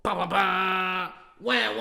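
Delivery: loud, slow drawn-out fanfare noises; mock fanfare. Podcast host hyping his quote with a loud mock fanfare. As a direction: blare it like an entrance theme.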